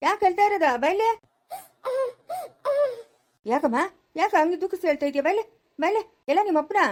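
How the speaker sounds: high, sing-song coaxing; coaxing a child. Acted. Grandmother coaxing a crying grandchild, high and sing-song. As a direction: coax the crying little one gently.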